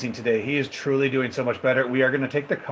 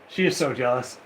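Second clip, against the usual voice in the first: usual speaking voice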